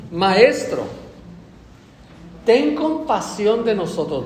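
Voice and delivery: imitating a shouted plea; crying out. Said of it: "a gritos le decían".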